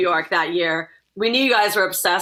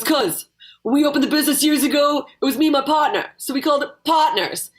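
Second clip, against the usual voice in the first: put-on high, brash Queens bar owner accent; 7 semitones higher than usual. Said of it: "my terrible Queens accent".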